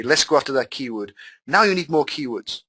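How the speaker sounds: normal talking voice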